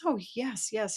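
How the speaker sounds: high, hasty storybook agreement; eager princess. Acted. Princess accepts the frog's offer to fetch the ball, high and eager.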